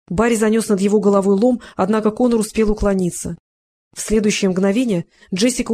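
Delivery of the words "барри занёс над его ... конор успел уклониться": ordinary delivery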